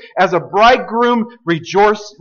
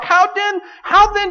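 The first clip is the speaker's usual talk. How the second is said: pleading cry; 13 semitones higher than usual. Acted. God pleads with His people.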